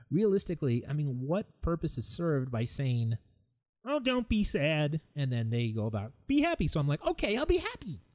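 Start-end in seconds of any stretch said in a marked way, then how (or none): 3.8-5.0 high, drawn-out mock cooing; hollow sympathy. Imitating hollow comforting. 6.3-6.7 high-pitched mock encouragement; forced cheer. Imitating someone ordering cheer. 7.1-8.0 very high, put-on cheer; fake happiness. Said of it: "And then I smile".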